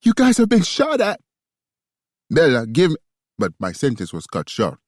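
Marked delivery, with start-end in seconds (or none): 0.0-1.2 high, loud shocked cry. A narrator's reading. Shocked woman on phone. 2.3-3.0 loud call. A narrator's reading. Man cut off by bullet.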